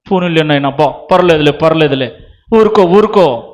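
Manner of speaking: put-on low, gentle hushing of a crying child; soothing parent. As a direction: comfort a little one, acted lightly. (బిడ్డను ఊరడించే తగ్గు స్వరం)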